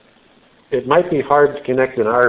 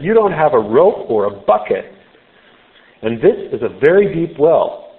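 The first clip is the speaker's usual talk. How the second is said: higher and louder, mocking disbelief; sarcastic; 5 semitones higher, 5 dB louder than usual. Samaritan woman scoffs at Jesus, higher and louder, sarcastic. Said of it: "you can almost sense the sarcasm in her voice".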